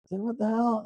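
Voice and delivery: high-pitched mock bewilderment; bewildered calling out. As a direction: call after vanished friends in a high voice. Retold, left alone, calling after friends.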